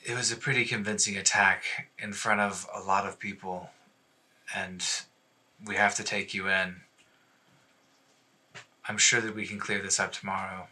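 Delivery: soft, subdued voice of a reluctant guard; sympathetic officer, reassuring. Acted. Okoria explaining why they must take her in, quietly reassuring. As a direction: break it to them gently and quietly.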